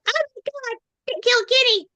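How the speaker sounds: high-pitched mock cartoon outcry; catchphrase impression. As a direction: squeal it like an outraged cartoon character. Quoting the South Park catchphrase.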